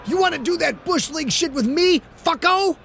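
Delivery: high, furious yell at the lineman; angry shout. Acted. Quarterback held back by refs, yelling at the lineman, furious. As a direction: shout him down, still raging. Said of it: "kept pointing and kept shouting".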